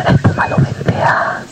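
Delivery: whispered, slow and secret; slow hushed whisper of suspense. A narrator's reading. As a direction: whisper it like the puma sniffing. Puma stalking, suspense.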